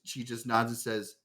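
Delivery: usual speaking voice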